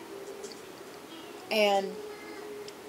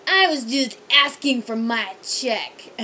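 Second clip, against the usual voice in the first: put-on whiny drunk; 2 semitones higher, 8 dB louder, slower than usual. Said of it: "he's like".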